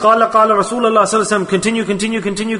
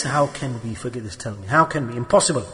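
much lower, much softer rhetorical question; hushed disbelief; 8 semitones lower, 8 dB quieter than usual. Real, not acted. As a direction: drop right down to a hushed, low aside.

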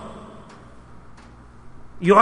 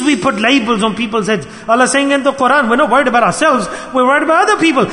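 raised, higher preaching voice building in force; fervent preaching; 4 semitones higher than usual. Acted. Preacher warning the congregation against judging others, fervent preaching. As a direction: preach it out to the whole hall.

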